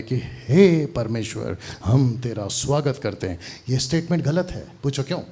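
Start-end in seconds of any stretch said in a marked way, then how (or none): 0.2-3.4 low, drawn-out imitation of a welcoming prayer; mock pulpit prayer. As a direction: intone it like a preacher addressing God, lightly parodied. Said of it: "जो कहता है pulpit में आने के बाद".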